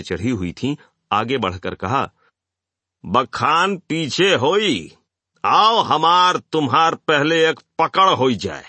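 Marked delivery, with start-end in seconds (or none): 3.0-8.6 high, drawn-out rustic challenge; intoxicated thug. A narrator's reading. Drugged thug challenges the speaker to wrestle, high loud swagger. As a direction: call him out like a tipsy ruffian. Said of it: "गुंडे ने, जिसकी आंखें भंग के नशे में".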